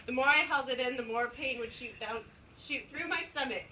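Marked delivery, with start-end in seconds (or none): none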